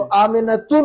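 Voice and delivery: आमिनतुन with an added "n" after the final "tu"; wrong tanween ending. Shown as a demonstration. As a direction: show आमिनतुन ending in a nasal "-tun". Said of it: "नहीं पढ़ेंगे इसको हम".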